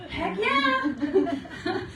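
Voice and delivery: high laughing Heck yeah; excited yes. Retold or imitated. Accepting the friend's offer to fly down, high and laughing. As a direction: jump at the free trip with a laugh. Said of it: "I'm like".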